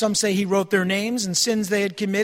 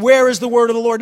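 taunting question; 8 dB louder, faster than usual. Retold or imitated. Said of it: "They're saying".

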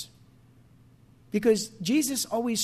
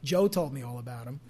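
ordinary talk

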